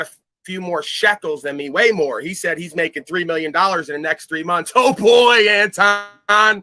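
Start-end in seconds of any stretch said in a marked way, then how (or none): 4.7-6.6 high and loud, sing-song jeer; mocking taunt. Real, not acted. Streamer jeering at rival Anton about his money, mocking. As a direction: taunt him like a schoolyard bully.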